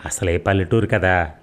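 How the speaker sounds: ordinary delivery, nothing marked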